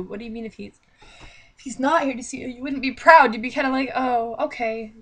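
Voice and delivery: normal talking voice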